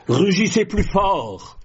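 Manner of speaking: high, forceful rallying cry; roaring command. Shown as a demonstration. Preacher tells believers how to answer the lion, roaring it. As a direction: roar it out to the congregation.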